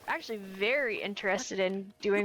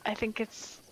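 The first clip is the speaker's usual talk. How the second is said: ordinary talk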